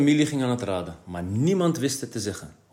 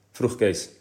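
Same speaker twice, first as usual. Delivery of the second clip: ordinary delivery, nothing marked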